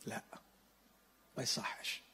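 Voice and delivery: soft voiceless whisper; the quiet voice of conscience; hushed inner voice. Acted.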